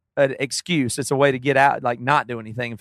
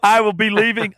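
dramatic wife; 6 semitones higher than usual. Retold or imitated. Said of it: "Women love to threaten".

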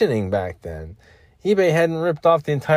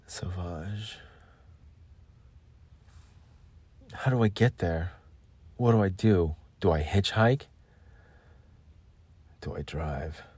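soft, hushed moody ad voice; perfume ad monologue; 2 semitones lower, 5 dB quieter than usual. Pretend. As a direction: breathe it like a cologne ad.

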